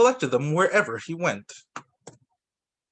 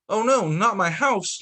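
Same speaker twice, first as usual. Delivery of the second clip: higher, louder cry of dismay; dismayed yell; 6 semitones higher, 3 dB louder than usual. A narrator's reading. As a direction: wail as the house falls. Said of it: "yelled Beaver".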